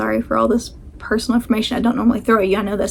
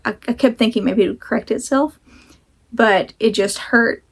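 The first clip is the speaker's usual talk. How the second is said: ordinary delivery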